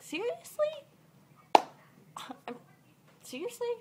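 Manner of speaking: very high, questioning disbelief; incredulous. Retold or imitated. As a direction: squeak out disbelief, rising at the end. Incredulous reply to manager's instruction.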